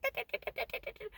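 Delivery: quick soft high chittering; rapid, airy staccato ferret chatter; excited ferret noises. Retold or imitated. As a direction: imitate a little animal chattering with excitement. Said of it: "she'd do these little noises when she was so excited".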